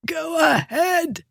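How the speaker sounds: high, slow, strangled croak. Acted. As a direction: squeeze it out half-throttled. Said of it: "the man croaked".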